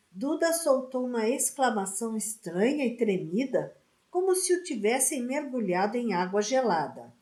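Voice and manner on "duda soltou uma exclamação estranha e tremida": ordinary delivery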